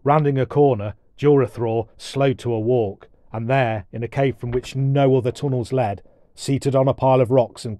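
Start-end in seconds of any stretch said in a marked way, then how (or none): none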